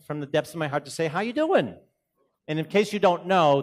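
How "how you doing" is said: a big Boston "How you doing?"; Boston accent hello, pitched up; Boston greeting. Shown as a demonstration. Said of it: "I wanted from Boston ... to say".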